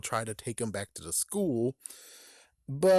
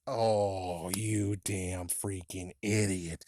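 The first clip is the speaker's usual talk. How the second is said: mock scolding; 4 semitones lower, much slower than usual. Scolding a character in a retold scene.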